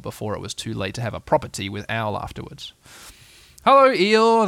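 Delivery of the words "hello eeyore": high, loud cheery hail; cheerful call. A narrator's reading. Friends arrive at Eeyore's, cheerful loud call. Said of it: "they called out cheerfully".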